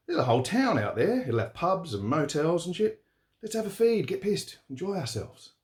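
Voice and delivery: put-on cheeky young bloke pushing for the pub; young Rich, eager. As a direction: coax your mate into a night out. Young driver Rich urging fun.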